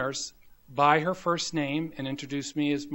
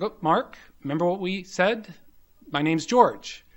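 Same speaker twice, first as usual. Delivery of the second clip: gentle correction; 4 semitones higher, 6 dB louder than usual. Retold or imitated. Attending corrects intern by name.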